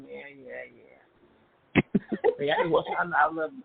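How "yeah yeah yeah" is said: soft, low grudging yeahs; a hint of a reluctant uncle mumbling; uncle's mumbled yeah. Imitating the uncle slipping up, quietly. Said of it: "He go".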